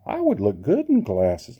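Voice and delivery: ordinary delivery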